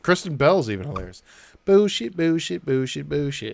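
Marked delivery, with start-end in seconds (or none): 1.6-3.5 mock British accent. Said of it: "mocking Russell Brand's character".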